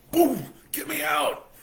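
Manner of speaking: high slow loud shout; heart shout. Retold or imitated. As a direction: play a pounding heart yelling. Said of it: "My heart's like".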